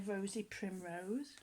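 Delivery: usual speaking voice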